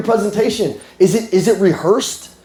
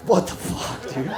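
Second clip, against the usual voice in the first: hushed shocked whisper; 10 dB quieter, slower than usual. Retold or imitated. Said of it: "She's like".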